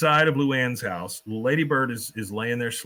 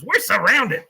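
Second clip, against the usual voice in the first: higher, louder alarmed shout; panicked cry; 8 semitones higher, 7 dB louder than usual. Retold or imitated. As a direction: cry out in war-flashback panic. Cotton panicking.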